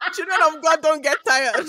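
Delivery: loud mock preaching; mock-preach it as a joke; teasing banter. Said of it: "we use it to banter now".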